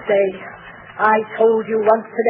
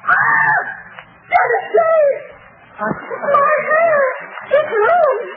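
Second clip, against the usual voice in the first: shrieking in horror; much slower than usual. Acted. Mother seeing her ruined hair in the mirror, shrieking.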